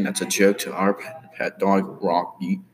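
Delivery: normal talking voice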